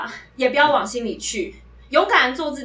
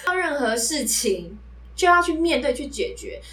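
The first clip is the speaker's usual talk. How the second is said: usual speaking voice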